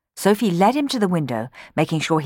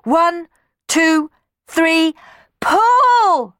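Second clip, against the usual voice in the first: high, loud heave call; shouting in unison; 13 semitones higher, 8 dB louder, much slower than usual. Acted. Children heaving on a rope, shouting the count. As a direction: call the count as they haul.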